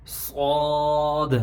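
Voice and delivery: thick, heavy صاد held very long; slow sustained صاد with a heavy s; full-mouthed صاد. Shown as a demonstration. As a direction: fill the mouth with the vowel of صاد. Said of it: "آواز منہ بھر کے آتی".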